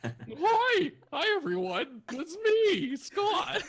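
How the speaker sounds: squeaky, very high, loud greeting; D and D character voice. Pretend. Player introducing himself to everyone, high D and D character voice. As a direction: announce yourself in a shrieking-high put-on voice. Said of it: "This is what he normally sounds like".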